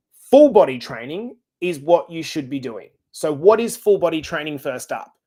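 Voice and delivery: ordinary delivery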